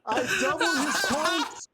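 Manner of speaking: high, slow ceremonial proclamation; grand pompous master. Acted. Host awarding Alex first place, mock-grand proclamation.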